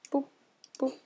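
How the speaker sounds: ordinary talk, nothing marked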